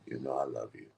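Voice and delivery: very low, soft teasing; deep lover's voice. Pretend. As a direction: tease her in a quiet bass. Playing deep-voiced lover to her.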